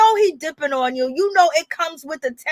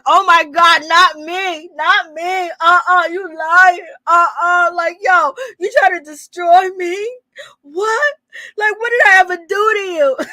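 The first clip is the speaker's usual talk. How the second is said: higher, loud indignant denial; mock cheater protest; 4 semitones higher, 3 dB louder than usual. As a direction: ham up a caught cheater playing innocent. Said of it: "Jock is acting like".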